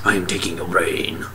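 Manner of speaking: soft, whispered monster threat; whispering alien. Retold or imitated. Alien grabbing the dude, mock-sinister whisper. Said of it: "he's all like".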